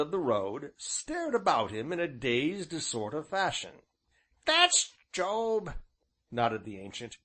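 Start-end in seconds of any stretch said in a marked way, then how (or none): 4.4-5.7 put-on high old countryman's voice; old rustic innkeeper. A narrator's reading. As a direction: voice the old villager, folksy and drawn. Ancient's dialect voice.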